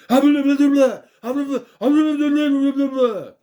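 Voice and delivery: high, loud yelled gibberish; loud hammed-up gibberish yell; mock Great Khali yelling. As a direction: bellow nonsense into a speakerphone. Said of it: "starts yelling into the speaker thing".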